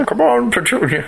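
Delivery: put-on high wavering old man voice. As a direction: urge the horse on like an old man. Mimicking the old man call.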